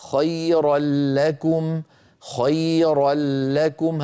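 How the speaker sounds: slow recitation, خيرًا لكم with the nun merged into a doubled lam; recited tajweed example. Shown as a demonstration. Teacher to students reciting full idgham into lam. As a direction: chant خيرًا لكم slowly as khayral-lakum. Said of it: "فصارت".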